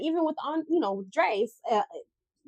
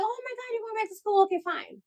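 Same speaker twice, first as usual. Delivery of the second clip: high-pitched imitation of friends reacting; lightly acted, high-voiced surprise; mock surprised reaction; 11 semitones higher than usual. Acting out others' reaction to school.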